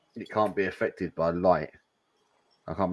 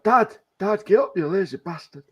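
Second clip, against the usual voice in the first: put-on much higher, cheeky child voice; mock kid voice; 10 semitones higher than usual. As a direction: play a rude kid waking his dad. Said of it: "It's like".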